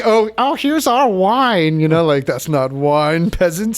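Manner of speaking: mock-haughty Roman scoffing at barbarian beer; snooty Roman. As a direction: sniff at the peasants' drink like a haughty aristocrat. Mocking snobby Roman about beer.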